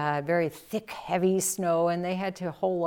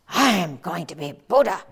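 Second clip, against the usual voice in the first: louder, fast boastful vow; proud declaration; 7 dB louder, faster than usual. Acted. Voicing Seppo's proud resolve.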